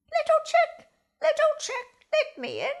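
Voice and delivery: high, loud sing-song call; merry call. A narrator's reading. Fox calling at the first chick's door, merry and high. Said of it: "he called merrily".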